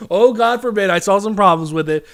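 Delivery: loud, exaggerated mock outrage; sarcastic mock horror. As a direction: say it as if confessing a scandal, sarcastically. Sarcastically repeats own admission louder.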